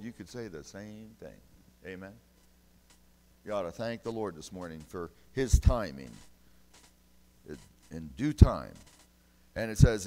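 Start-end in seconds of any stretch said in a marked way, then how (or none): 3.4-10.1 much louder revival preaching. Acted. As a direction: drive it home to the congregation. Preaching at full force.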